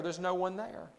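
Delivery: ordinary talk, nothing marked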